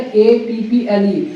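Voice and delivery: "ए पी पी एल ई" spelled slowly, letter by letter; letters spelled separately. Shown as a demonstration. Teacher spelling apple for students, "ए पी पी एल ई" slowly letter by letter. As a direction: give each letter of "ए पी पी एल ई" separately. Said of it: "क्या बोला जाता है?".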